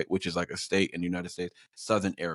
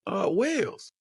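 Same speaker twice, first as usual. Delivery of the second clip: high, faked British answer; imitated friend faking a Londoner; put-on London accent; 12 semitones higher, slower than usual. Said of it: "trying to put on the accent".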